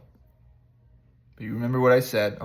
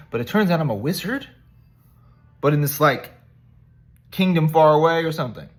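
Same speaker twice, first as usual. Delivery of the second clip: higher, louder, quick amazed Harry; excited; 6 semitones higher, 9 dB louder, faster than usual. Retold or imitated. Orphan boy learning he's a wizard, excited and fast. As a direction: rush it out in giddy disbelief. Harry marvels at his news.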